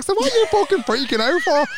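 shrieky, high-pitched retold outburst; exasperated. Traveller asking friend why she's freaking out, high mock exasperation.

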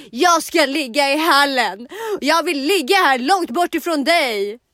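loud, slow, defiant declaration; acted angry shout. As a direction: shout each phrase out like a drama queen. Acted quarrel with boyfriend. (högt, utdraget, trotsigt skrik)

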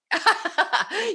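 high, loud burst of real laughter. Real, not acted. Laughs at colleague's joke.

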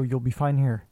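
normal talking voice

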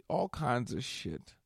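ordinary talk

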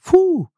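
high, loud whew of admiration; real high whistle-like exhale; impressed whew. Real, not acted. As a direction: let out a high-pitched, wowed whew. Wowed by the double entendre.

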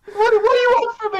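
high-pitched mock bewilderment; exasperated squeal. [name] retelling his reply to the Scottish co-worker, high mock exasperation. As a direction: squeak out a baffled plea.

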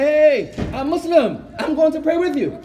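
high, loud, eager announcement; proudly hollered news, pitched high; excited calling out. Retold or imitated. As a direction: call out to friends across the way. Calls out to brothers going to pray.

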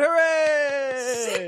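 high cheering shout; excited cheer. Acted. Host celebrating the points, high cheer. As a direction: cheer like a crowd.

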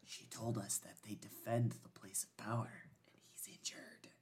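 hushed, voiceless whisper. Real, not acted. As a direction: keep it secret, all in a whisper. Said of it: "Why are we whispering?".

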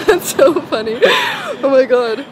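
laughing as you say it, high and bright. Real, not acted. Host amused by guest's offer to sell his house, laughing. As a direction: let the laugh carry the words.

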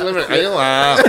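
mock Limerick accent. Pub podcaster answering a dare, mock Limerick accent. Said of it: "That's, uh, Limerick".

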